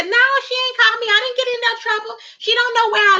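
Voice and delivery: high, fast sassy imitation; mock impression of a woman running her mouth. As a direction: rattle it off like she won't stop talking. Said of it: "all the mouth".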